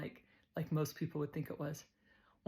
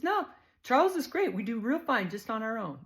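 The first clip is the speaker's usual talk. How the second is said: much higher and louder, beaming; proud Too Tall; 5 semitones higher, 5 dB louder than usual. Retold or imitated. Too Tall tells the narrator her son is great, proud and bright. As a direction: proudly brush off the pity.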